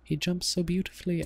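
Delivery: high, marvelling nature narrator; David Attenborough. Acted. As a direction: gush over the animal in a bad British narrator voice. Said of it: "get my David Attenborough voice on".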